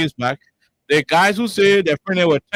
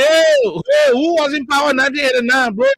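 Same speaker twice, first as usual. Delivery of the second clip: loud, much higher hey hey, then pressing the question; calling out; 8 semitones higher, 3 dB louder than usual. Real, not acted. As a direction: shout to cut through the argument. Host shouts to cut in.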